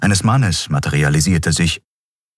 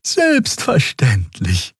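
slow, friendly reassurance; friendly assurance; 8 semitones higher, much slower than usual. A narrator's reading. Xea promising the guard to report, slow and friendly. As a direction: reassure the officer kindly. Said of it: "versicherte Xea freundlich".

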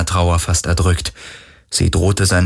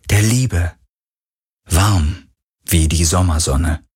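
plain reading voice

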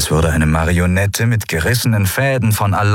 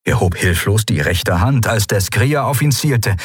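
ordinary delivery, nothing marked

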